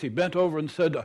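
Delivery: plain reading voice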